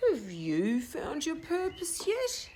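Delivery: slow, measured owl voice; deep slow owl voice. Acted. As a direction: ask it slowly like a patient old owl. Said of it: "in a voice that was deep and slow".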